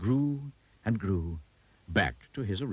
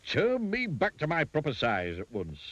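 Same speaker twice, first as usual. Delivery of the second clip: higher, indignant demand; spluttering rage; 7 semitones higher than usual. A narrator's reading. Shrunken Mister Uppity orders the goblin, spluttering rage. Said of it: "spluttered Mister Uppity in a rage".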